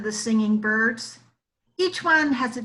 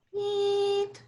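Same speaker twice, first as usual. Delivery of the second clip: high, soft peeping call; woodcock call; 9 semitones higher, 4 dB quieter, much slower than usual. A narrator's reading. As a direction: peep it out at dusk. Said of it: "calls when the sun has set".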